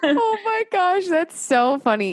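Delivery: high, slow laughing gush; amused delight. Real, not acted. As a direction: stretch it out through real laughter. Laughing at troll reply.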